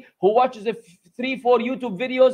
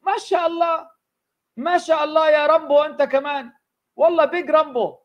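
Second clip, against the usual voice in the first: sarcastic mock praise; 5 semitones higher than usual.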